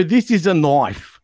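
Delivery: slow, hammed-up Crocodile Dundee line; joking Australian accent; mock Australian accent. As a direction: drawl it out like a movie Aussie. Crocodile Dundee quote parody.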